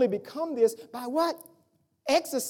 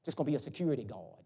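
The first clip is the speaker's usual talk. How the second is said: weary brother quoted; 10 semitones lower, 8 dB quieter than usual. Retold or imitated. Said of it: "That's what he said".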